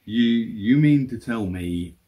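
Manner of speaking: ordinary talk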